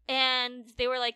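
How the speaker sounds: ordinary talk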